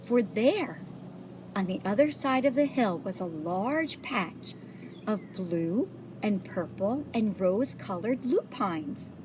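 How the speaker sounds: ordinary delivery, nothing marked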